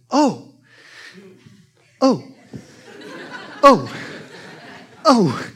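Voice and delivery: high strained oh on each rise; mock groans. Imitating the kid doing knee bends, high straining oh. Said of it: "it got funny, 'cause every time he came up, he went".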